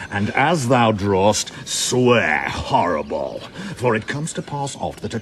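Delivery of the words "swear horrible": ordinary delivery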